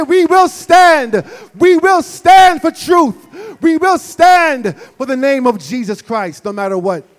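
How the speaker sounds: loud, high preaching prayer at full force; shouted prayer. Acted. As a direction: cry out to God over the congregation. Leads a loud congregational prayer.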